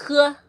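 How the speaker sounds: he with a rough h, as if gurgling; emphasize the scraping h at the start of he; strong-friction h. Shown as a demonstration. Teacher saying the example word, he with a gurgled h. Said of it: "emphasize on the H part".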